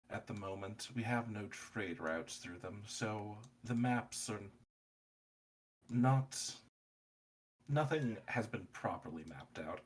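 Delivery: measured, halting librarian relishing the refusal; understated formal refusal with deliberate pauses; lightly voiced stuffy librarian. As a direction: lightly play an official regretfully turning someone away.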